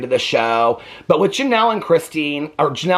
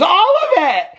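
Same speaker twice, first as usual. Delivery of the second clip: loud, high mock wail; mock crying; 5 dB louder than usual. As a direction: wail it, imitating someone in tears. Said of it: "sobbing and crying".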